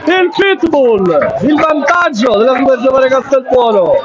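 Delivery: rising to a roaring goal call; breathless italian goal commentary. Real, not acted. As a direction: scream the goal, stretch the name. Goal scored, commentator erupts.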